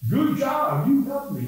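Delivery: high, loud bright praise; praising child. Retold or imitated. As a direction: cheer a little girl on. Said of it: "saying what I felt".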